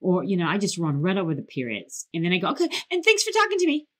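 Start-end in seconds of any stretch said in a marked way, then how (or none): 2.5-3.9 fast, higher, tumbling words; nervous fan rushing a goodbye; rushed thanks. Retold or imitated. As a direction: blurt it out without a breath. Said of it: "I talk so fast that I don't even pause".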